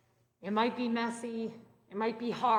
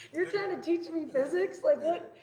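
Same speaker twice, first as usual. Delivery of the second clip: higher, louder mock incredulity; retold disbelief; 7 semitones higher, 3 dB louder than usual. Student reacting to physics teacher's voice, mock incredulous. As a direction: imitate your baffled reaction, pitched up.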